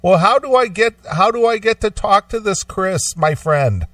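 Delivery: put-on high Indian accent telemarketer; Indian accent impression. Caller mimicked to friend in high Indian accent. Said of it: "thick Indian accent".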